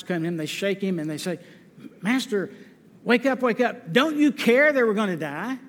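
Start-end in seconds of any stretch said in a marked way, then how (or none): 2.0-5.6 mild mock panic, pitch climbing on wake up; a touch of alarm, rising higher; panicked disciples. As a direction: plead like frightened men waking him in a storm.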